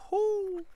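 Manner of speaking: high falsetto whoo of praise; excited whoop. Real, not acted. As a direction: cheer with a shrill whoo. Cheering Dad's reply.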